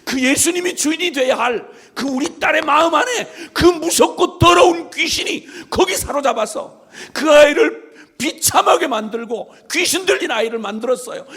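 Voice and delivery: high, loud sermon voice with a cry in it; a fervent pulpit cry; impassioned preaching. Real, not acted. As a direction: preach it at full force. Preaching at full force.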